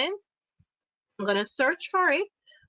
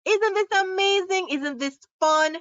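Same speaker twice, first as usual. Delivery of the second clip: excited; 8 semitones higher, 3 dB louder than usual. Acted. Teacher gushing over the demo.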